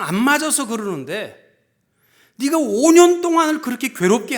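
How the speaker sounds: loud, impassioned scolding from above; God's rebuke. Retold or imitated. God scolds the pastor over a suffering member, stern loud rebuke. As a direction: voice a stern divine reproach, swelling loud. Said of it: "나보고 하나님께서 꾸짖으시는 거예요".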